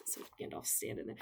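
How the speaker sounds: whispered in awe; awed whisper. Real, not acted. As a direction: breathe it out in reverence. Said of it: "I sound like such a nerd".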